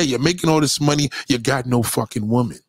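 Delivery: ordinary talk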